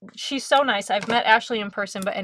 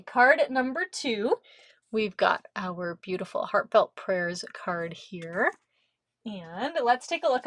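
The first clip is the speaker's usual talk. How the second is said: normal talking voice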